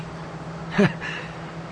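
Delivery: airy chuckle; breathy old man laugh; old man's soft laugh. Acted. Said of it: "He laughed".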